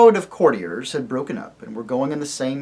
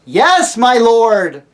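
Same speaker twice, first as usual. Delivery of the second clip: loud high bawl into a trumpet; 8 semitones higher, 9 dB louder, much slower than usual. Acted. Eustace to the deaf dwarf, loud bawl. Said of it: "bawled Eustace into the trumpet".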